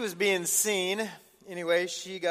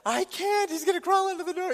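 high, loud imitated kid's protest; hammed-up frightened whine; mock panic; 13 semitones higher, 5 dB louder than usual. Daughter refuses to sleep near a spider, mock panic. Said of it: "And she's panicked".

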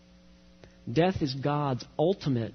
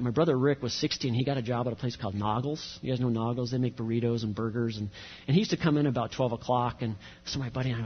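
normal talking voice

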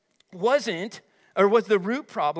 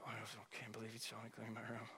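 low, hushed grumble under the breath; murmuring; 9 semitones lower, 25 dB quieter, faster than usual. Acted. Kid told to clean the room, murmuring. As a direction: imitate a kid muttering complaints, fast and barely audible. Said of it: "That's murmuring".

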